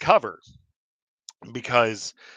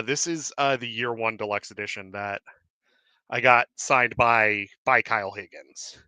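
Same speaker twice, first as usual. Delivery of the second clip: usual speaking voice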